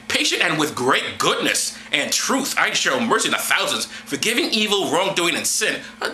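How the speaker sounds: usual speaking voice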